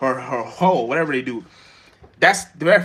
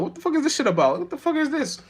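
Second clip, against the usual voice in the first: high, incredulous imitation of himself; baffled mock disbelief; 10 semitones higher than usual. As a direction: act out being completely lost by the movie. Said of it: "I'm like".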